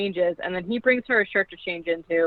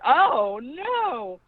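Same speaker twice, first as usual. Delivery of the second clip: mock dismay; 5 semitones higher than usual. Her own reaction to the scene.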